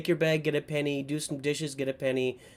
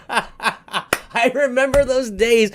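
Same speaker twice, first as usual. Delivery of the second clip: laughing fondly; 7 semitones higher, 6 dB louder, much slower than usual. Real, not acted. Chuckling over kids' movies.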